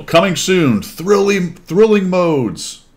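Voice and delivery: slow, high, hammed-up movie trailer. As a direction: stretch it like a dramatic trailer. Reading trailer text mockingly.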